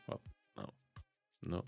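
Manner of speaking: ordinary delivery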